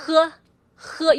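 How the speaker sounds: he with a rough h, as if gurgling; emphasize the scraping h at the start of he; strong-friction h. Shown as a demonstration. Teacher saying the example word, he with a gurgled h. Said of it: "but with a strong friction".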